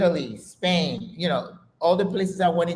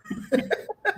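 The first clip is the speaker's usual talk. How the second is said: sudden high laugh bursting out; a real, high peal of laughter; 3 dB quieter than usual. Real, not acted. Laughing at French fries joke.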